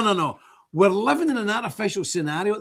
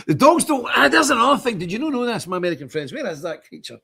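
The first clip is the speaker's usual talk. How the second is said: ordinary talk, nothing marked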